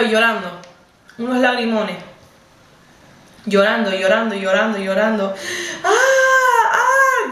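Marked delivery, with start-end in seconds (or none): 5.8-7.3 very high, sustained mock wail; imitated crying scream. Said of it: "Gritando, gritando, gritando, llorando". (imitación de grito llorando, muy agudo)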